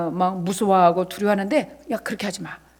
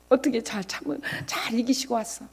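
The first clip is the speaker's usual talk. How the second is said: usual speaking voice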